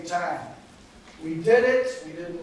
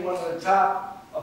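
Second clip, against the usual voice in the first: normal talking voice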